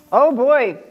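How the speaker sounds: plain reading voice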